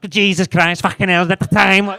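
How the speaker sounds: loud, rough Scouse morning shout; Scouse outburst. Acted. Wife waking up first thing in the morning, shouted in Scouse. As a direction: bellow it like a startled Liverpudlian. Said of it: "she's got a wee bit of Scouse".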